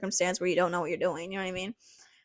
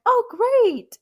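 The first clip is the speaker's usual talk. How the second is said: squeaky, much higher delighted "great"; delighted surprise; 13 semitones higher, 5 dB louder than usual. Retold or imitated. Told the doctor likes her, squeaky delighted reply. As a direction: jump way up in pitch with glee.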